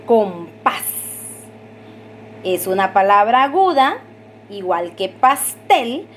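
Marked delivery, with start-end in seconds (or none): 0.1-1.0 "Compás" split up, weight on PÁS; stress on last syllable. Shown as a demonstration. Teacher demonstrating an aguda word, "Compás" stressed on PÁS. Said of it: "su acento se escucha en la última sílaba". 5.1-6.1 "pastel" slowed, weight on TEL; stress on last syllable. Shown as a demonstration. Teacher showing where the accent is heard, slow "pastel" stressed on TEL. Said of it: "su acento se escucha en la última sílaba".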